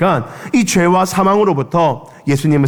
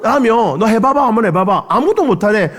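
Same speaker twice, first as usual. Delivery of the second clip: much higher, sing-song jeer; teasing taunt; 7 semitones higher than usual. Retold or imitated. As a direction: dare him, laughing at him. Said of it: "사람들이 놀렸어요".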